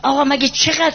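ordinary delivery

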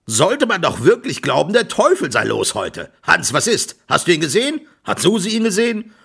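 high, urgent volley of questions; worked-up farmer, pitch climbing; agitated questioning. A narrator's reading. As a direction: demand answers in exasperation.

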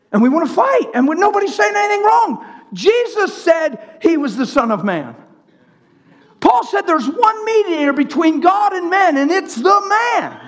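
high, forceful sermon voice; fervent preaching. Acted. Preacher arguing with those who wanna fight, fervent preaching. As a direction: drive the point home high and hard.

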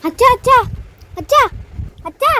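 calling out. Real, not acted. Child calls father about crying mother.

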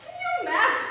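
squealed with laughing delight; laughing, thrilled. Acted. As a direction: gush with glee. Gushing over the gossip.

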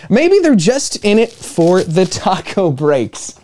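put-on lively read-aloud for children; animated children's story narration; storybook reading. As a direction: read it like a bedtime picture book. Reading picture book to kids.

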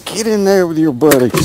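loud, high, cheering the fish in; cheering the catch into the well; happy shout. Real, not acted. Angler putting the fish in the livewell, cheering loudly.

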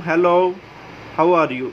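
normal talking voice

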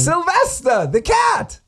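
high, proud cartoonish announcement; goofy contestant. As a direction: announce it like a proud little kid. Silly contestant repeats.

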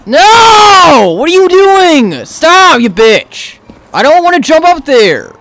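high, loud angry yells; real anger at the bad jump; angry shouting. Real, not acted. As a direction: yell at the screen. Teammate or game betrays him.

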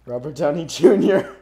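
Robert Downey Junior. Pretend. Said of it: "It's I".

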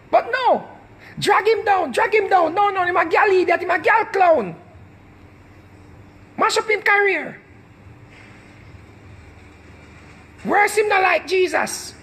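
high sneering mock mob voice; mocking online mob. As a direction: jeer like the crowd dragging him down. Mimicking the mob.

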